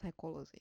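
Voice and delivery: quick サイコロジー, accent on コ; stress on first O. Shown as a demonstration. Teacher recapping the -ology rule, サイコロジー stressed on コ. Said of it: "最初のオロジーのOにアクセントがありますね".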